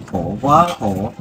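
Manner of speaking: real tearful lament, falling away. Real, not acted. Old father searching for his missing daughter, tearful. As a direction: let it sink as if holding back crying. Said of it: "ông đừng khóc nhá".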